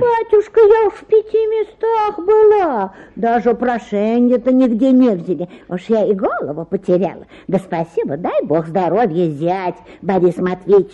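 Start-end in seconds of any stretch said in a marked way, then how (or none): 0.0-5.1 wailing, higher and louder; тоном плачущей просительницы; crying. Acted. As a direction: break into a tearful wail. Petitioner wails to the official.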